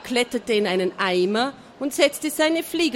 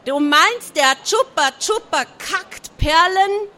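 loud astonished bellow, higher; loud yell; 7 semitones higher, 6 dB louder than usual. A narrator's reading. Schrottauge bellowing his amazement to all. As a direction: yell it in disbelief. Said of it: "der brüllte so laut".

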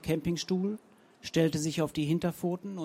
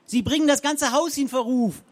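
higher, louder booming scold; 8 semitones higher, 5 dB louder than usual. A narrator's reading. Frau Zenker booms across the front garden, loud and high. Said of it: "Das Organ der Zenker dröhnte".

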